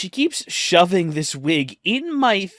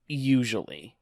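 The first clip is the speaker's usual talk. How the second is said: doubtful aside; 5 semitones lower, 6 dB quieter than usual. Shown as a demonstration. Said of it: "I'm usually not bothered".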